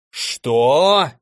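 put-on old man's drawn-out, rising disbelief; understated roar of outrage; mild outraged roar. A narrator's reading. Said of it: "взревел старик". (сдержанный возмущённый рёв)

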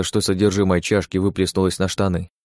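plain reading voice

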